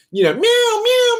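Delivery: high, slow mock cat meows; imitated meows. Imitating the cat meowing at the door, high and slow. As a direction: meow like the cat, high and drawn out. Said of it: "he'll meow".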